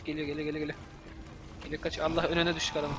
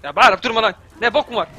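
loud, higher angry yell; angry shout; 4 semitones higher, 10 dB louder than usual. Real, not acted. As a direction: shout back at the screaming enemy. Yelling at shrieking game enemy.

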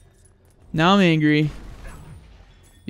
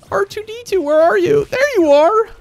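very high, loud put-on call; 6 dB louder than usual. Calling for a lost little droid, high calling out. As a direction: sing it out way up high.

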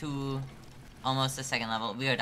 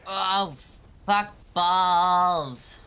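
loud, high drawn-out curse; high yell, stretching the last word; dismayed shout; 6 semitones higher, 8 dB louder, much slower than usual. Real, not acted. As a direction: shout it out as the game goes wrong. Dismay at a slip in game.

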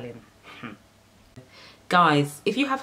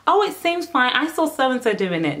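mock excuse voice; 9 semitones higher, 3 dB louder than usual. Mocking a viewer's excuse.

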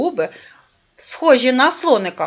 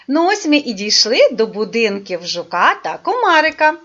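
normal talking voice

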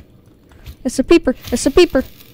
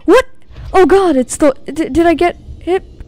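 high, loud gasp and panicked questions; startled panic; 7 semitones higher, 5 dB louder than usual. Real, not acted. Player suddenly hit in game, gasps in panic. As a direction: gasp and blurt in alarm.

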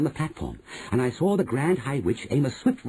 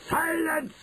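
very high witch's cry; shouted command. A narrator's reading. Grand High Witch silencing her witches, high shout. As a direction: pitch it sky-high to silence them. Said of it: "shouted the Grand High Witch".

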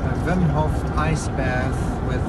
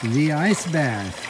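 ordinary delivery

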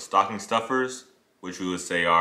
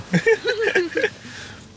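trembling whiny yelps. Shown as a demonstration. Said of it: "My Courage the Cowardly Dog voice".

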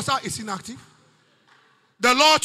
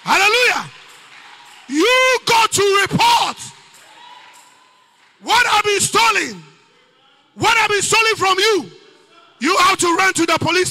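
preaching; 6 semitones higher, 6 dB louder than usual. Acted. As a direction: drive the question home to the church. Preacher pressing the congregation.

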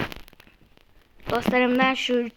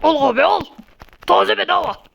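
playful vendor hawking; street vendor call; 2 semitones higher, 3 dB louder than usual. Pretend. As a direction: call out your wares like a seller. Hawking goods in game.

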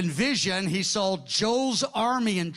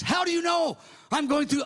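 shocked exclamation; 9 semitones higher than usual. Retold or imitated. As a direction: blurt it out in shock. Shocked man in retold scene.

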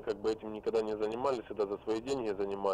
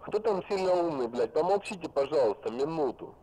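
irritated; 7 semitones higher than usual. Real, not acted. Snapping at chatters to shut up.

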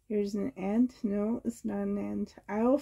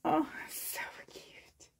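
tearful whisper; 6 semitones higher, 10 dB quieter than usual. Real, not acted. Overcome while opening gift.

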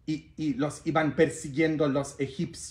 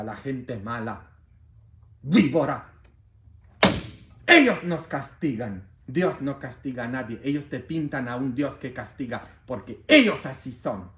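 ordinary talk

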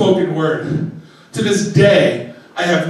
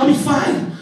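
raised, high-pitched insistence; retold argument; 7 semitones higher than usual. Man assuring his girlfriend he'll be fine, mock arguing. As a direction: insist as the argument starts to heat up. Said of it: "yelling argument with her".

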